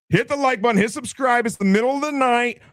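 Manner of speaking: high-pitched streamer hype voice; hype plug. Pretend. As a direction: push the plug in a high, strained voice. Mock hype channel plug.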